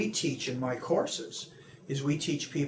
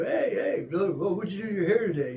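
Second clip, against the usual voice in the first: teasing friend; 6 semitones higher, 3 dB louder than usual. Retold or imitated. Teasing friend voiced in example.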